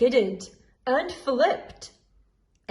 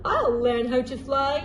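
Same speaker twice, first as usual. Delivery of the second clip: usual speaking voice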